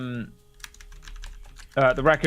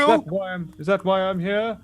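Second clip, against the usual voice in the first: higher-pitched, hesitant seal voice; Jack the seal; 9 semitones higher, 3 dB louder than usual. Acted. Seal back on land offers enchantment, halting and high. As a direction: ramble haltingly, pitch lifted, unsure of your use.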